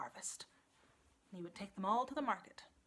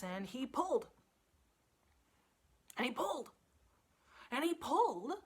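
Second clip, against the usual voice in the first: rising, louder with each pull; building strain; 4 semitones higher, 4 dB louder than usual. A narrator's reading. Narrator building the farmer's pulling, louder each time. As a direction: heave harder each time.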